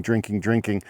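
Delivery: ordinary talk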